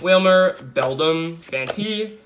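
ordinary delivery

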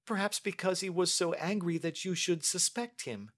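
put-on high-pitched, earnest young lady's voice; pleading young lady. Niece defending her cousin to her uncle, pleading.

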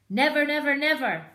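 high, loud repeated cry. Acted. Boggis backing the others, high loud cry. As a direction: shout it to the sky. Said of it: "cried Boggis".

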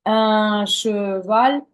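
very slow "un cheval" with sounds kept apart; slow separation. Shown as a demonstration. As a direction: keep the consonants from merging. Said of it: "щоб вони не зливалися".

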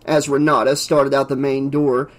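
ordinary delivery

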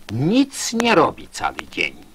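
ordinary talk

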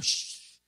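long reproving "shh"; acted shushing. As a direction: hush someone with a drawn-out shh. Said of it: "muchos los reprendían y le decían".

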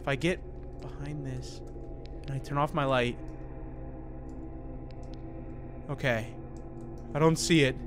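soft, low hiding voice; hushed. Real, not acted. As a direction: keep it down while hiding. Hiding from monster.

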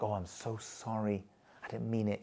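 acted meek, hushed sorry; calm apology. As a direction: keep it gentle and measured, placating. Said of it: "So I gotta be calm".

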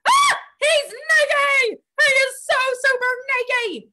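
high, loud, slow shocked cry. Acted. Teacher shocked the duck is naked, high and loud. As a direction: gasp it out, shocked he's naked.

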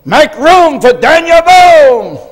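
high, loud, slow shouted command; slow triumphant shout; defiant shout. Retold or imitated. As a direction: bellow it to the whole schoolyard. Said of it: "and I said".

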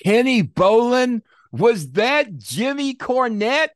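very high, slow, drawn-out mimicked voice of the host; Vince Russo impression. Host asking on his show, in a high slow mock voice. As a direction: stretch each word up high like a startled host. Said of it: "if you know the voice".

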